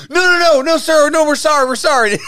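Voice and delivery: high, loud frantic begging; panicked pleading. Retold or imitated. Restaurant staff begging the raging customer, panicked. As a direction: beg him to stop in a panic.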